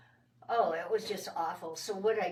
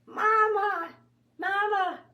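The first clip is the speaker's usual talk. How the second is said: put-on high, loud child's call across the yard; yelling for mama; 12 semitones higher, 7 dB louder, slower than usual. Little girl stuck in tree yells for mama. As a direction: call out for mama, much higher and louder. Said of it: "and I was yelling".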